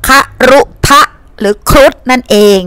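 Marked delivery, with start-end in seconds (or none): 0.1-1.0 คารุทะ broken into three separate syllables, คา-รุ-ทะ. Shown as a demonstration. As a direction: sound out คารุทะ one syllable at a time. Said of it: "มาจากคำว่าคารุทะ". (ออกเสียง คา-รุ-ทะ แยกทีละพยางค์)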